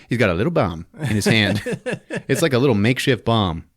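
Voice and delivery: ordinary delivery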